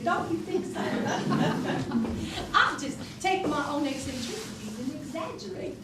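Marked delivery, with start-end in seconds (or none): 0.0-2.5 giggly. Shown as a demonstration. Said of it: "a little giggle there".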